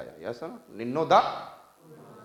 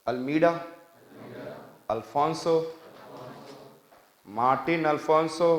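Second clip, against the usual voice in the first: Almeida, Alfonso, Martin Alfonso as separate prompts, long pauses between; repeat-after-me; 2 semitones higher, slower than usual. Shown as a demonstration. Teacher drilling governors' names with the class, Almeida, Alfonso, Martin Alfonso spaced out. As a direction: recite each name for the class, Almeida and Alfonso ending lifted. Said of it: "चलिए बोलिए".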